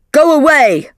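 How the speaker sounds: loud angry yell; shouting. Acted. McLaren yells at visitors from behind his door. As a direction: bark it back through the door. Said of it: "McLaren shouted from behind the door".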